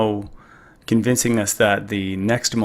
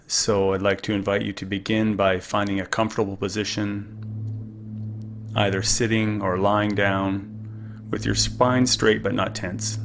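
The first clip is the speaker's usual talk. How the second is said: calm; 4 dB quieter than usual. Acted. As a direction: gently guide the listener into stillness. Leads a guided breathing meditation.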